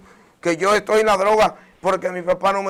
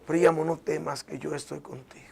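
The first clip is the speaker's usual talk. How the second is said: soft breathy whisper, softer than his voice; hushed, tender whisper; 4 semitones lower, 8 dB quieter than usual. Retold or imitated. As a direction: whisper it like a gentle voice in the ear. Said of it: "una voz susurró en mis oídos, suave".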